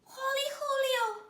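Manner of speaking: high, loud squeal. A narrator's reading. Kitten seeing himself in the mirror, squealing. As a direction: squeal with delight. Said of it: "squealed the kitty boy".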